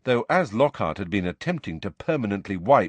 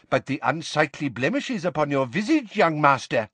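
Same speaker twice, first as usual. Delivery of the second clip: put-on higher, pompous old-fashioned declaiming; medieval healer; 6 semitones higher than usual. A narrator's reading. Portrait healer pronouncing doom to a boy, pompous. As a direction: proclaim the diagnosis grandly.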